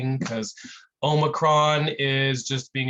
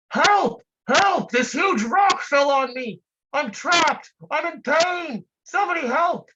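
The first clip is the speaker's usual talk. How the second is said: much higher and louder, frantic cries for help; panicked trapped man; 12 semitones higher, 5 dB louder than usual. Acted. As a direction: yell up from under the rock, desperate.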